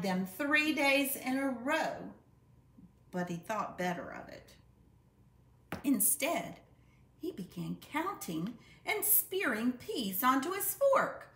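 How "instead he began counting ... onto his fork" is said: ordinary delivery, nothing marked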